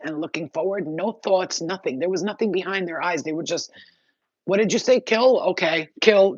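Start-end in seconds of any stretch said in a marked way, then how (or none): 4.4-6.3 lightly voiced drone, higher and louder; acted obedient drone. Drones answering an order to kill, eagerly obedient. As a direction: play a drone taking an order.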